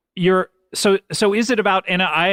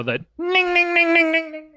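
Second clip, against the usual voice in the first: high nasal-free ning sweeping past like a passing train; Doppler train sound; 14 semitones higher than usual. Shown as a demonstration. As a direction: mimic a train whizzing by with a pitch drop. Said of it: "I have, of course, the Doppler effect train".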